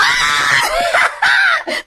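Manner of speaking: high shrieking laugh. Real, not acted. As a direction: let out a squealing laugh. Laughs at own mix-up.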